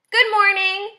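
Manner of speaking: high, friendly ladybug greeting; cheery high-pitched character voice. A narrator's reading. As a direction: greet brightly as a friendly little bug, pitched well up. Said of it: "said the friendly ladybug".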